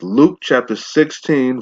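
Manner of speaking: normal talking voice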